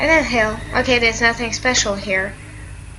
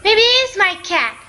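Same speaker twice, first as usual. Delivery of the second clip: put-on higher-pitched, surprised girl character; girl looking for her cat; 10 semitones higher than usual. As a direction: lift the voice as a curious heroine. Voices game girl seeking cat.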